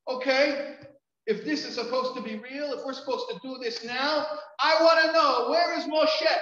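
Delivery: high, loud outburst building to a full shout; loud, high-pitched retold tirade; retold screaming. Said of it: "I was screaming at the top of my lungs".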